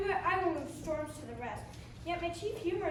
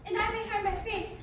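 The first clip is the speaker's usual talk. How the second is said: usual speaking voice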